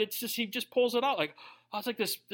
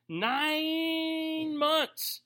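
slow it right down to show how long nine months feels; stretched out slowly; 8 semitones higher than usual. Real, not acted. Preacher stretching the wait out, slow and drawn out. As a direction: drag the words out long and high.

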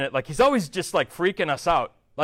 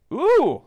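high, loud rising-falling "ooh"; mock-shocked ooh; 9 semitones higher, 7 dB louder than usual. Preacher reacting to cynicism in the verse, loud mock-shocked ooh. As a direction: react as if that remark stung.

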